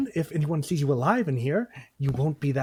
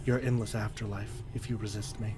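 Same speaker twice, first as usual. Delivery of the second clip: menacing forgotten god; 4 semitones lower, 10 dB quieter than usual. Acted. Dark god threatening the dead hero, low and menacing. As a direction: speak as an undying god who owns the dead.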